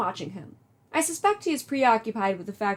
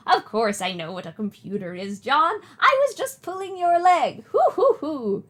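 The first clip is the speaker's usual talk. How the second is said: high, playful granny voice; a grandmother pulling his leg; teasing old lady; 5 semitones higher, 4 dB louder than usual. Acted. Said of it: "do an old lady voice".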